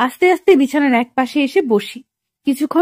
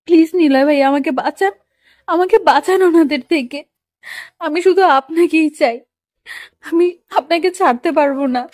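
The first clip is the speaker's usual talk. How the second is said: acted tearful plea, pitch raised a little; tearful pleading; 3 semitones higher than usual. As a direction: plead for rescue with a touch of sobbing. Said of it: "এসব বলে আর ফুপিয়ে ফুপিয়ে কাঁদে". (কাঁদো কাঁদো গলায় মিনতি)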